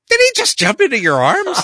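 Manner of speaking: very high, loud mock disbelief; incredulous. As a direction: squeal it, imitating an astonished partner. Acting partner's amazed reaction.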